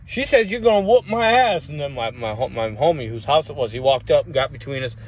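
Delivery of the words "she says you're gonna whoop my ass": high-pitched acted-out confrontation; cocky challenge, reenacted high; retold challenge. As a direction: replay squaring up to someone, voice pitched up. Retells confronting the biker boyfriend.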